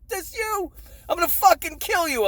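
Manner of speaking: high-pitched mock threat from a furious mother; squeaky mom voice, playfully threatening; imitating angry mom. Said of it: "She's like".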